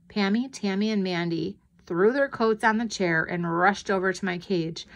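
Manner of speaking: ordinary delivery, nothing marked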